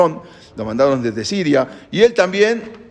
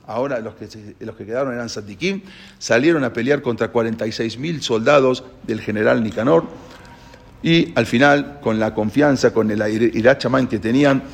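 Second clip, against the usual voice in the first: usual speaking voice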